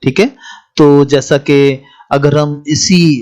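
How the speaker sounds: normal talking voice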